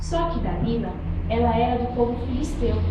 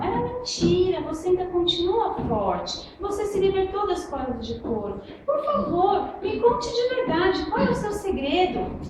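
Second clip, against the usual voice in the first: pleading Dalila; 7 semitones higher than usual. Acted. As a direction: beg him for the truth. Dalila reclama e pede segredo. (Dalila suplicando em voz aguda)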